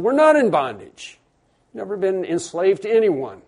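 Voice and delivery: imitated indignant objection; indignant denial. Crowd's indignant denial, acted in sermon.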